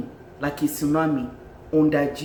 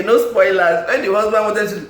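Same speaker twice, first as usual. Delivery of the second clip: ordinary delivery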